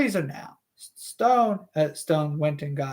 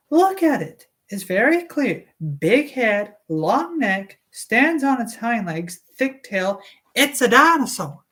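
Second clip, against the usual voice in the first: higher female character voice; Alice Levin voice; 5 semitones higher than usual. Acted. Alice Levin to Doctor Stone, higher female voice. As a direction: play a chatty mother, pitched up. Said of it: "some sort of female voice".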